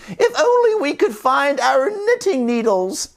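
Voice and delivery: put-on high old-lady voice; sisters. Sisters wishing for their needles, high voice. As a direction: wish it aloud in a high voice. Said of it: "said the sisters".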